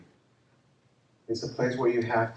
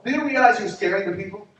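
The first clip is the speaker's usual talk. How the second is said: scolding; 9 semitones higher, 8 dB louder than usual. Retold or imitated. Pastor confronts armed gang member.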